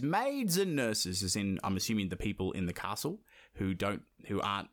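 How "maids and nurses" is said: slow, drawn-out call to the maids. Retold or imitated. Vasilisa summoning her servants with a slow call. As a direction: call the servants slowly, a hint of command. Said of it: "clapped her hands and cried aloud. She goes".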